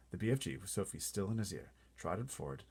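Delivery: usual speaking voice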